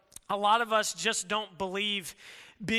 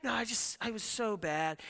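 softer fake-modest moan; mock self-pity; 6 dB quieter than usual. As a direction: whine it like fishing for affirmation. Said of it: "you'll be like".

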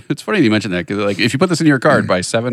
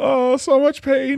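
mock pain; much slower than usual. Mock pained voice.

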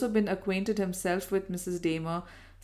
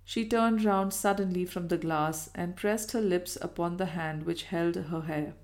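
ordinary delivery, nothing marked

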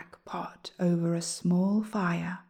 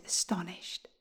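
usual speaking voice

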